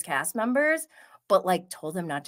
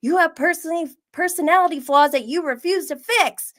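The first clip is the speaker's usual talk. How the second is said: higher, louder indignant call-out; mock call-out; 9 semitones higher, 4 dB louder, faster than usual. Fed-up castmate confronting Siati, higher louder indignant tone. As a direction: play the fed-up castmate calling him out. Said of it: "He's like".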